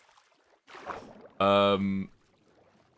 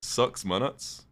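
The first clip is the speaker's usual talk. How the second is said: slow, higher New Zealand SIX MINUTES, like 'sucks munuts'; New Zealand accent; 5 semitones higher, much slower than usual. Retold or imitated. Repeating how the sailing guy said it, New Zealand accent. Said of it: "was the way he said it".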